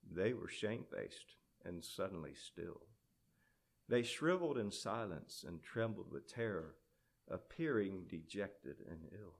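low, very soft storyteller; hushed narration. A narrator's reading. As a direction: drop to a fearful hush. Narrates the cowed demons.

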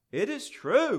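high, loud insistence; countering. A narrator's reading. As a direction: protest it emphatically. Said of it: "countered Jealousy".

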